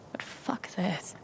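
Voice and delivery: whispered aside. Real, not acted. [name] muttering to herself.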